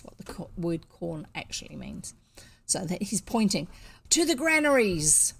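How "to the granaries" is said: high, loud command to march; ringing orator's call; rallying cry. A narrator's reading. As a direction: urge the crowd onward with a shout. Orator pointing, urging the crowd on.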